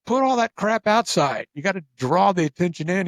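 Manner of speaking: retold pushy advice, punched out loud; emphatic urging. Retold celebrity urging store owners.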